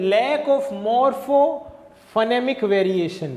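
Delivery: very slow, word by word; slow dictation. Shown as a demonstration. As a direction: dictate the term slowly. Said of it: "slow dictation of term".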